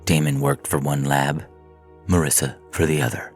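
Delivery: ordinary delivery, nothing marked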